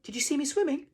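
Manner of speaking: very high, loud excited squeak; Roo squeaking excitedly. A narrator's reading. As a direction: squeal it out, thrilled with yourself. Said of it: "squeaked Roo excitedly".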